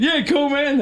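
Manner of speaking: mock cool reply. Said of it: "So I was like".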